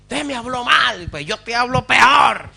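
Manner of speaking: rising into a shout on the last words; preacher acting out a churchgoer who answers rudeness with a shout; mock angry retort. Acting a retaliating angry reply.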